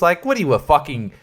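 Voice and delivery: ordinary delivery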